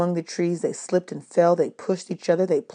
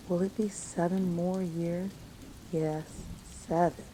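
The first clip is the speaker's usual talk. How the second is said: much softer, dull and defeated; children facing seven more years; hushed despair; 11 dB quieter than usual. A narrator's reading.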